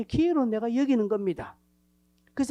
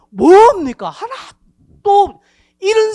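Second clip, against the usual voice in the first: indignant shout; 13 semitones higher, 16 dB louder, slower than usual. Acted. Preacher's outburst of rebuke.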